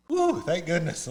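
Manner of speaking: loud, high, drawn-out sigh of relief; relieved biker. Retold or imitated. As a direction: imitate a biker loudly relieved. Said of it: "one of the guys goes".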